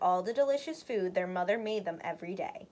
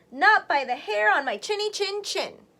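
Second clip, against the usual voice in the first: higher, louder piggy defiance; little pig crying; 8 semitones higher, 6 dB louder than usual. Acted. Little pig defies the wolf, higher and louder. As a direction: chant it back defiantly. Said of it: "cried the little pig".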